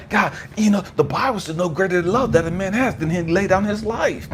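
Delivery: high, impassioned plea to God; fervent prayer. Retold or imitated. Young believer offering his life to God, fervent pleading. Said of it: "I remember talking to God".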